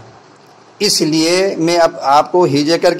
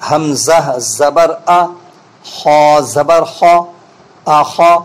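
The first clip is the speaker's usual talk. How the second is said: careful correct spelling; much slower than usual. Shown as a demonstration. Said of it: "اس کو غور سے سنیں".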